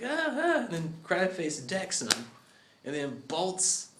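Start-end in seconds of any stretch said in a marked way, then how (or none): 0.0-0.6 a high, loud startled yelp; strangled cry. Acted. Kid reacting to the awful truth, a high strangled cry. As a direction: gasp like you've been hit. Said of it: "And the kid's like".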